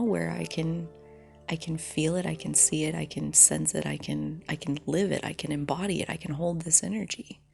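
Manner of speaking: ordinary talk, nothing marked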